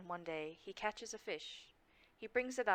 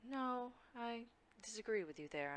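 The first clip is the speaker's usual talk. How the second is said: normal talking voice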